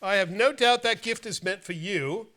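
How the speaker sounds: ordinary talk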